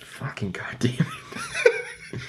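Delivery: hushed, slow whisper, acted out; drawn-out whispered swearing; whispered curse. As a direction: curse under your breath, imitating exasperation. Retold muttered curse after a fight.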